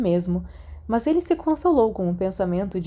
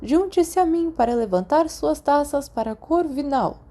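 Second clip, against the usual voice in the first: put-on a higher, proud old headmaster addressing the hall; headmaster's speech; 7 semitones higher than usual. A narrator's reading. Dumbledore addressing the students at the feast, proud and higher. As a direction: speak to the whole hall like a beaming headmaster.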